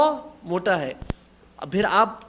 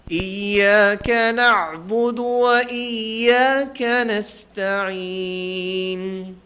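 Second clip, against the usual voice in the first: slow, drawn-out chanted tilawat, louder; Quran recitation; 3 dB louder, much slower than usual. Shown as a demonstration. Teacher reciting to class, slow chanted tilawat. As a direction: chant each word long and steady.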